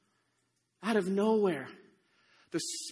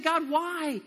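pleading disbelief; 7 semitones higher, 3 dB louder than usual. Acted. Gideon questions God's decision.

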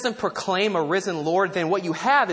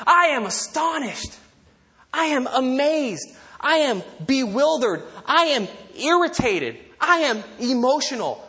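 angry astonishment; 5 semitones higher than usual. Acted. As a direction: hammer out each statement as Paul, appalled. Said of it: "he is angry, frustrated".

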